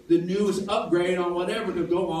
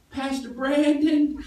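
put-on higher, slow child's call; little girl voice; 7 semitones higher, 4 dB louder, slower than usual. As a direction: call him timidly like a small girl. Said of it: "the first word she says to me is".

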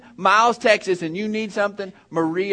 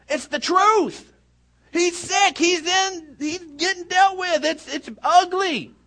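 very high, strained insistence; preacher's high-pitched, emphatic outburst; impassioned protest; 12 semitones higher than usual. Acted. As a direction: argue back at a sky-high pitch. Acts out blunt impassioned reply.

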